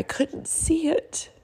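slow, high and tearful; crying. Real, not acted. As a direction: let the voice break on the words. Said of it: "sorry about the cry".

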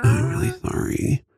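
lispy whisper; leaning in to whisper. Pretend. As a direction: whisper with a lisp. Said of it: "The more I whisper".

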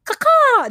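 high, loud squawk like a crow; caw-caw squawk like a startled bird. Retold or imitated. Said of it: "sound she makes sounds just like a bird".

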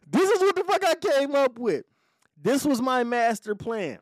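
laughing disbelief at himself. Real, not acted. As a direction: talk through the laugh, high and loud. Laughing at his own scheme.

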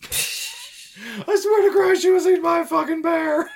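very high, loud hammed-up shout; mock outraged yell. Husband on Forensic Files swearing about the bear, mock outraged yell. Acted outburst in mock crime-show interview.

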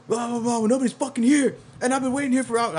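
high-pitched mock rant into a phone; retold angry rant. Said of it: "still yelling at him".

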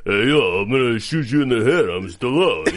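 lightly done low Stallone voice, a touch tough; a cheesy Vegas-style Stallone, barely put on; bad Stallone impression. Guy leaving the movie theater hams up Stallone, lightly voiced. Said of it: "the world's worst, most stereotypical ... impression".

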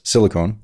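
"Silicone" ending in a long "cone"; corrected pronunciation. Shown as a demonstration. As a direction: say "Silicone" with the last syllable as "cone".

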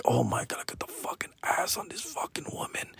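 hushed unvoiced whisper of awe. Teen ogling a woman beside his dad, awed whisper. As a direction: whisper it in disbelief. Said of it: "staring at this chick, like".

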